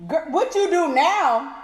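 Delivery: ordinary talk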